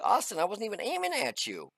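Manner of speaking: high-pitched, baffled protest to his son; shocked protest. Retold or imitated. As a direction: plead that it was an accident. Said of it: "I'm like".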